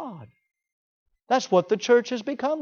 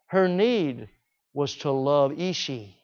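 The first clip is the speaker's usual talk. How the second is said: usual speaking voice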